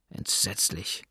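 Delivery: whispered, barely audible; horrified whisper. A narrator's reading. Old man hearing of the murder, whispering in horror. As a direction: breathe the word out in shock. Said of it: "sagte der Alte".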